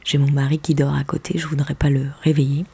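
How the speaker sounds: hushed low whisper. Real, not acted. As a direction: whisper beside a sleeping husband. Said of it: "Je parle pas très fort parce que".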